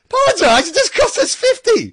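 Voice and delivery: very high, loud imitated outrage; outraged retort. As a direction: squawk it back in mock disbelief. Acting out player's outraged reply to umpire.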